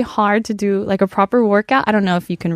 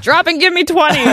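drill sergeant; 8 semitones higher than usual. Retold or imitated. Acting a gym drill sergeant.

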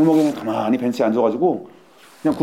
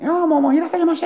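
high schoolgirl voice. Retold or imitated. Imitating Japanese schoolgirls chatting.

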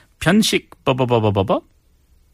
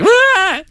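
a sudden high yelp of hurry; panicked yelp; 5 dB louder than usual. Retold or imitated. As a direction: yelp like you're rushing. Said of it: "Yeah, like".

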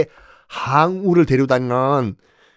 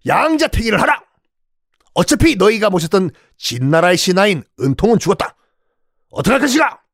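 commanding shout, pitched higher; 6 semitones higher than usual. Acted. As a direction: bark orders at the whole hall. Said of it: "그때 항량이 항우와 함께 외칩니다".

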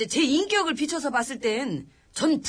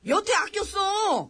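mock shout; 9 semitones higher, slower than usual. Real, not acted. Retort to mumbling colleague.

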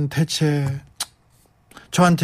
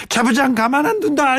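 mock threat; 10 semitones higher than usual. Host voices a listener's joking threat.

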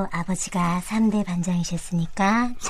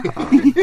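laughing; 7 semitones higher, 5 dB louder than usual. Real, not acted. Comedy skit laughter.

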